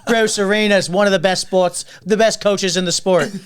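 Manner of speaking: loud, high sudden soccer expert; Jerry. Retold or imitated. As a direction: lecture like an instant Boston expert. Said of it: "act like you're fucking massively into".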